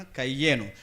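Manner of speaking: normal talking voice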